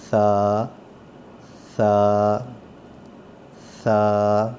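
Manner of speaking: سا with the tongue tip between the teeth, soft th sound; not a sharp whistling س, softly; soft tha. Shown as a demonstration. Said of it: "نرم کر کے پڑھنا ہے". (سا نرم، زبان دانتوں سے لگا کر)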